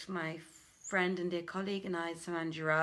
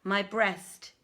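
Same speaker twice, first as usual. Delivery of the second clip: louder, declaiming storyteller voice; dramatic story reading; 5 dB louder than usual. Acted. Reading the Arabian Nights to a lockdown audience, loud declaiming narration. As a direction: read the tale out grandly to listeners.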